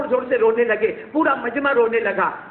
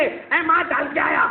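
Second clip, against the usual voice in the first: cheeky son; 7 semitones higher than usual. Acted. Mocking a defiant son.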